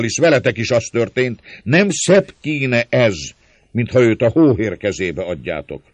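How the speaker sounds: loud, high harangue to a crowd; jeering Márton. A narrator's reading. Márton urging the crowd to let her beg, loud mocking harangue. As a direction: whip the crowd up with a cruel joke.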